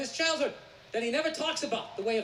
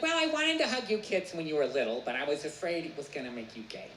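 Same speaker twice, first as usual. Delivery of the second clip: mother explaining; 7 semitones lower than usual. Retold or imitated. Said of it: "She's like".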